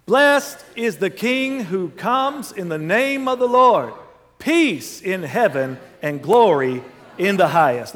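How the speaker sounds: loud, drawn-out crowd acclamation. Pretend. Preacher leading congregation as the crowd hailing Jesus, loud voice. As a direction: proclaim it slowly and loudly like a rejoicing crowd. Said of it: "read these words like they did with a loud voice".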